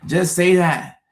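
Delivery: begging for plain words; exasperated plea. Real, not acted. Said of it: "repeated plea".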